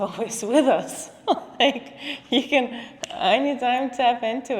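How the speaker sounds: real laugh bubbling into the explanation. Real, not acted. Teacher telling the group the senses are always there, laughing.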